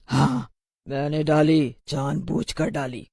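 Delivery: slow, low witch voice; defiant witch. Acted. The witch admits it to the pupils, slow and defiant. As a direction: gloat over the confession, dragging it out.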